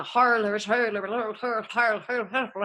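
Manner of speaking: garbled, rolling Irish-style noises; mock Irish accent. Shown as a demonstration. Attempting Irish for the producer, a nonsense mock Irish accent. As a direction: babble a cartoon Irish brogue. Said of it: "Scottish or in Irish".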